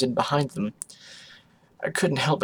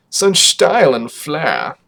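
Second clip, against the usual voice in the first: pompous drawl; 6 dB louder, slower than usual. Acted. Father agrees pompously.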